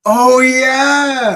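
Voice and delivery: high, drawn-out burst of recognition; excited, long-held high exclamation; delighted recognition. Real, not acted. Friend remembers the Liam Neeson storyline, delighted. Suddenly recalls a favourite storyline.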